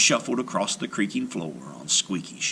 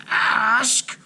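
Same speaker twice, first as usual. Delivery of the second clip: witch voice; 14 semitones higher, 4 dB louder than usual. A narrator's reading. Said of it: "interrupted Baba Yaga".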